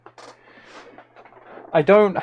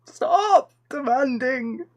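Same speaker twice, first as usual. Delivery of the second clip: mock whine; 9 semitones higher, slower than usual. Pleading with chat, whiny.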